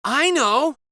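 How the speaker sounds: high, loud eager exclamation; know-it-all kid with a sudden idea, loud and eager; exclaiming. A narrator's reading. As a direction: blurt it out triumphantly. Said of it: "exclaimed one know-it-all".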